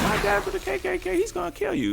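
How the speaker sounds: very high, thin-volume imitation of a kid yelling; mock child's scream. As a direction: mimic a screaming child in falsetto, without real volume. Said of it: "some child being like".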